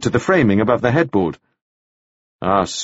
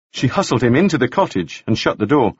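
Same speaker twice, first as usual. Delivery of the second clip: plain reading voice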